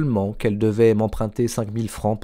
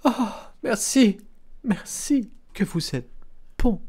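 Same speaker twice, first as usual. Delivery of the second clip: overwhelmed cry of thanks; 10 semitones higher than usual. A narrator's reading. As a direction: cry out thanks in a high voice, then weaken. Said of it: "Elle cria". (cri de gratitude aigu, ému)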